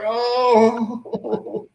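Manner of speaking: high, loud "oh" of dismay; imitated crushed groan. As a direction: wail it like a let-down kid. Child hears there was no autograph.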